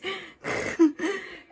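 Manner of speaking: short, high laugh; real laugh. Real, not acted. Laughs after asking about noise.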